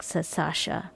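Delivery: plain reading voice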